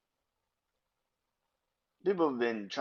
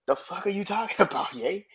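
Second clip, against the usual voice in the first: high, louder disbelief with a laugh; incredulous outburst; 8 semitones higher, 3 dB louder than usual. Real, not acted. Vlogger calling out [name], loud and incredulous. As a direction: throw it at him in disbelief.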